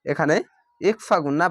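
usual speaking voice

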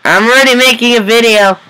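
loud high annoyed reply; real call out. Real, not acted. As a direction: shout back to the other room. Answering a family member offscreen.

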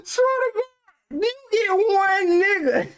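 slow, high-pitched plea; drawn-out high voice laying down the rule; high strained pleading. Pretend.

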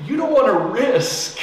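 high and loud, pressing the warning; urgent pleading. Retold or imitated. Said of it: "I pleaded with her".

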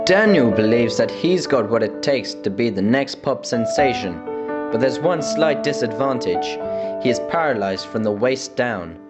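normal talking voice

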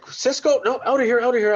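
high, loud brush-off, hammed up; mock dismissive yell. Chef waving off the Sysco rep, a mock loud dismissal. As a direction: shoo the salesman away loudly and high.

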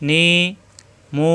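নি and মু each with the vowel held long; stretched syllables. Shown as a demonstration. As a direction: draw out নি and মু for one alif. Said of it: "এভাবে লম্বা করতে হবে".

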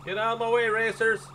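loud, pushy call to clear the way. Real, not acted. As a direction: yell it across the road at the other racers. Shouting at racers in game.

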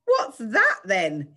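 slow, loud, high mock surprise; exaggerated drawn-out pretend puzzlement; deliberate double take. A narrator's reading. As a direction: feign astonishment, stretching the words. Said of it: "did a deliberate double take".

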